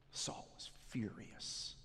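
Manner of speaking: preacher building suspense before the massacre, whispered; hushed whisper. A narrator's reading. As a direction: whisper it like a dark secret. Dramatic turn in sermon story.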